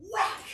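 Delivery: a sharp shouted whack; mock slam noise; loud whack sound. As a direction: smack the word out like the blow.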